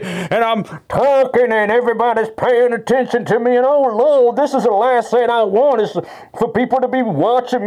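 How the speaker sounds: high, loud, hammed-up preacher wail; mock melodramatic politician. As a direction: ham it up like a showboating lawmaker praying for the cameras. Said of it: "like these real dramatic performances".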